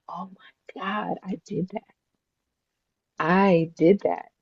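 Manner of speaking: hushed, slow whisper of amazement; retold awestruck near-whisper; awed. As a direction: breathe it very softly in wonder. Retold awe at seeing newborn.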